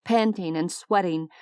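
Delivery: plain reading voice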